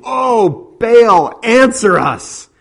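loud, high desperate shout; frantic prophets' cry to Baal. Acted. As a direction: scream to a silent god.

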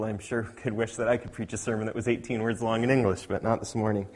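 ordinary talk

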